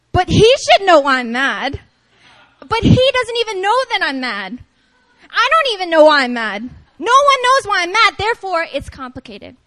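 put-on comic girlfriend rant, punched loud; hammed-up indignation, voice jumping up; exasperated girlfriend. Mad at a boyfriend who has no idea, mock outrage. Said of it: "really angers me".

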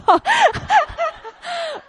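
high bursting laugh; burst of laughter. Real, not acted. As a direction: laugh out loud at herself. Laughing at own story.

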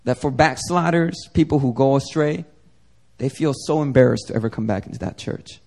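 ordinary delivery

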